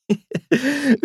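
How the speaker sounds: bright, high burst of laughter. A narrator's reading. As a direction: laugh at your brother's crash. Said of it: "nachdem sie sich vom Lachen beruhigt hat".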